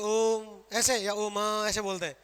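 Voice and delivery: first ओम said short, second ओम drawn out long; ओम short then long. Shown as a demonstration. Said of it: "ओम कैसे बोलते हैं?".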